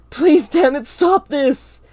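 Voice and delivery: loud, high desperate shout; tearful yell. A narrator's reading. Narrator yells at Ava to stop, tearful shout. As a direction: yell through tears. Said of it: "I yell".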